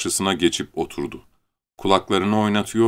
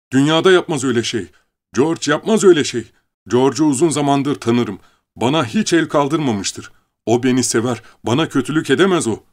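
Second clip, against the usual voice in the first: higher, louder, fast angry protest; 6 semitones higher, 4 dB louder than usual. Acted. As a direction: defend George in anger. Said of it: "iyice öfkelenmişti". (öfkeyle, yüksek ve hızlı itiraz)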